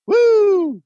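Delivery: high, loud celebratory whoop; excited whoop. Retold or imitated. Host reading a fan's text, whooping. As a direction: let out a big whoop.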